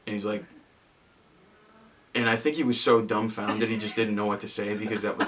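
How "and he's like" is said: ordinary delivery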